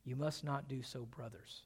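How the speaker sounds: soft, tender "You must not do so, brothers"; gentle appeal, "brothers" dropped low and very quiet; loving. Retold or imitated. David addresses men he loves as brothers, tender. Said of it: "David loves these men".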